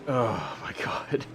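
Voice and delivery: breathy whispered disbelief; exasperated whisper. Real, not acted. Reacting to being wrecked.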